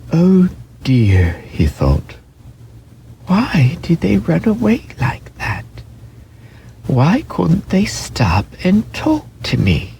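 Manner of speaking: high, wistful little voice; sad lonely thought. A narrator's reading. Toy rabbit alone in the bracken, wistful and sad. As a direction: wonder sadly why they left.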